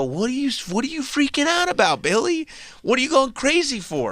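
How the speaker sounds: high, put-on voice of a dismissive girlfriend; mock Rose. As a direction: brush him off in a high silly voice. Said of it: "she'd go".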